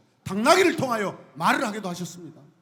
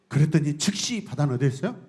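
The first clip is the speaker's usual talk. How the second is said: normal talking voice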